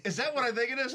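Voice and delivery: high, incredulous question; can't believe what you're seeing; disbelief. Retold or imitated. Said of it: "I'm like".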